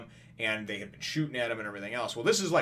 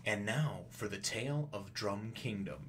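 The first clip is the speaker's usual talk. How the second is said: slow, softer storybook narrator voice; 6 dB quieter, slower than usual. Acted. Narrator coming in to open a flashback, slow and hushed. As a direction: intone it slowly, like a flashback voice-over. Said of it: "narrator coming in and going".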